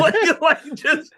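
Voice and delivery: breaking into high laughter mid-word; giggly high break; laughing. Real, not acted. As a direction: laugh through it. Laughing at own joke.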